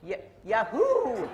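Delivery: Yahoo as a loud, slow, laughing whoop; excited whoop. Shown as a demonstration. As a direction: cheer the word Yahoo out, laughing. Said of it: "that my Canadian accent showing?".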